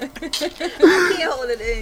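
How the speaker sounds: trying not to laugh; slow, giggling through the words; stifled giggles. Real, not acted. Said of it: "I try not to laugh".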